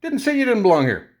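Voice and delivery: heated retort. Retold or imitated. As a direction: raise it, you're defending the cashier. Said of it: "I'm like".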